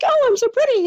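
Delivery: high, airy, self-admiring squeal; mock your younger self preening on the street; vain pretty girl. Mocking younger self, vain and very high. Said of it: "I felt like".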